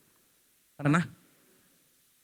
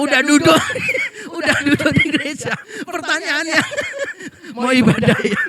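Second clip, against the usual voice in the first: words spilling out through laughter; laughing at his own joke while telling it; 9 semitones higher, 6 dB louder than usual. Real, not acted. Preacher laughing to congregation about awkward questions, giggling through words.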